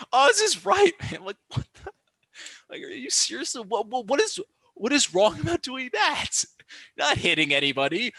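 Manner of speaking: high-pitched, sputtering disbelief; incredulous rant. Real, not acted. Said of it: "Sorry, my little rant".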